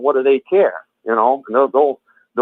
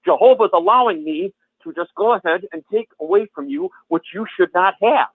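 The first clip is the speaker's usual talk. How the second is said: higher-pitched, insistent retold justification; making it clear; 8 semitones higher than usual. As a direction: imitate someone laying down their authority. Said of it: "They're making it clear".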